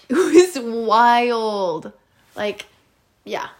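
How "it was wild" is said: laughing through a slow wild; laughing, drawn out. Real, not acted. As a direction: stretch it out with a laugh.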